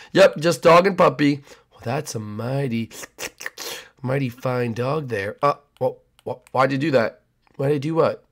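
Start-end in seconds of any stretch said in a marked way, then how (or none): 1.7-5.4 creepy neighbor. Acted.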